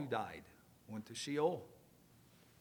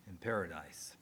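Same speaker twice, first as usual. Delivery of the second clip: dying whisper, in paradise breathed out; a hushed whisper from the cross; 10 semitones lower, 8 dB quieter than usual. Acted. Quoting Jesus's dying promise.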